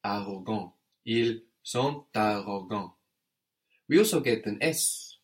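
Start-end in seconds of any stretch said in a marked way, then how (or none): 1.1-2.9 "ils sont arrogants" with T sounded after "sont"; optional liaison. Shown as a demonstration. Teacher shows the second option, "ils sont arrogants" with linking T. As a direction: link "sont" into "arrogants" through a T. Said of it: "liaison there with the T on the end of sont".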